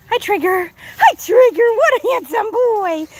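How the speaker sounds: high, slow cooing praise; pet talk. Real, not acted. Owner admiring her goat Trigger, high slow pet talk. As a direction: coo over him like a proud owner.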